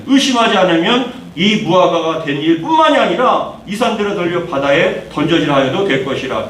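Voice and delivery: normal talking voice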